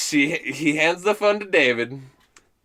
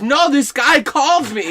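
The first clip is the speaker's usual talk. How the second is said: high, drawn-out protest; mock-indignant high mom voice; insistent mother; 10 semitones higher, 4 dB louder than usual. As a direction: imitate an exasperated mom insisting. Mother argues with son.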